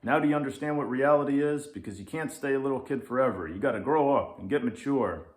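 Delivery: fast, loud ranting lynx; grumpy lynx. Acted. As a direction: rant at the kid without a breath. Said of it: "says the Grumpy Lynx".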